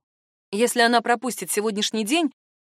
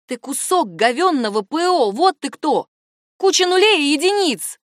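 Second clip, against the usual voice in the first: raised, indignant tirade; furious insult; 6 semitones higher, 5 dB louder than usual. A narrator's reading. Woman insulting her AI assistant, angry and rising. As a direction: hurl insults, rising in pitch and force.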